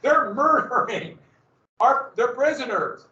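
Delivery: high, stretched-out cry of alarm; alarmed outcry. Acted. Escaped prisoners running back to Fort Duquesne, alarmed cry. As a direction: cry out the terrible news.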